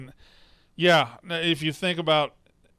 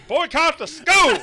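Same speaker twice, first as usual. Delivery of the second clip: hammed-up mock yell, much higher and louder, slow, through a laugh; overacted yell; 13 semitones higher, 8 dB louder than usual. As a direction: parody a dad overacting his outrage. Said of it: "he just overacts it".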